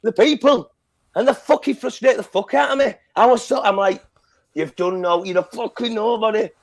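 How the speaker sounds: high-pitched real exasperation; worked-up ranting. Real, not acted. Fan tearing into Brandy as a nobody, worked up. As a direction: let the frustration run away with you. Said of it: "Sorry, I'm getting worked up".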